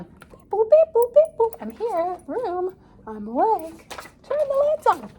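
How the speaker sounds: very high, loud beeping robot voice; robotic voice. Real, not acted. Talking to the room lights, high robot beeps. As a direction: playfully talk like a robot.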